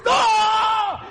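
high shouted no; terrified yell. Retold or imitated. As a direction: yell in horror. Reliving the cutting. (grito de horror)

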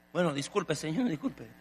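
lightly voiced neighbor woman, meek; meek apologetic lady. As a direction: shrink back and apologize.

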